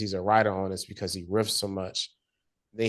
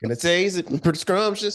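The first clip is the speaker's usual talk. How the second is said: higher, laughing, savoring tone; flirty put-on voice; 9 semitones higher, 4 dB louder than usual. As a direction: relish it mockingly. Continuing the ogling voice.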